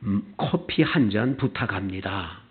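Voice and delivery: low, measured coffee order; politer request. Acted. Customer reads the menu's polite order, courteously. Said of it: "너무 불친절하고 (메뉴판을 바꿨냐면)".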